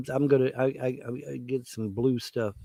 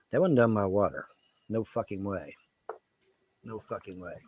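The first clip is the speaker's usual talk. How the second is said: ordinary delivery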